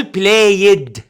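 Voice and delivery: "بلايد" drawn out as play-yed; wrong extra syllable. Shown as a demonstration. Teacher warns against this form, slow two syllables. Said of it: "مش هقول".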